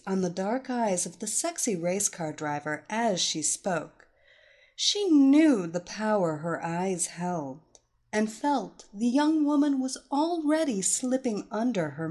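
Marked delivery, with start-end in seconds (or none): none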